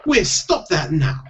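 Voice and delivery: high, scolding Scottish wife; impatient stage-Scottish voice, high-pitched; snapped Scottish. A narrator's reading. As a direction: snap at him in a Scottish accent. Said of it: "snapped Jeanie".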